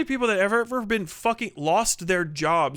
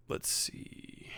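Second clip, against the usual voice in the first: whispered to self; 17 dB quieter than usual. Real, not acted. Searching for the file.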